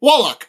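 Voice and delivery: Wallach said loud and high; corrected pronunciation. Real, not acted. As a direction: Wallach proudly corrected, falling. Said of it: "The inflection".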